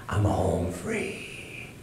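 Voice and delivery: soft, slow whispered triumph; hushed thought. Retold or imitated. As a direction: breathe it out in secret.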